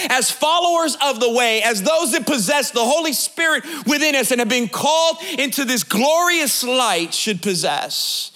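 ordinary talk, nothing marked

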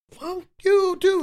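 high-pitched mock yell of a neighbor; imitating a Harlem neighbor's high shout; yelled from a window. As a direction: fling it out the window at the street. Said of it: "heard somebody come out of their window and go".

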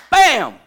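loud, booming "bow" like a crash; explosive impact noise. Retold or imitated. Speaker acts out his high school tackle, explosive crash. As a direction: shout out the hit as a sound effect. Imitated impact while telling story.

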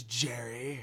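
low, quiet seething name; Wayne Knight voice. Retold or imitated. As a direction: hiss out the name with spite. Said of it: "Wayne Knight kinda goes".

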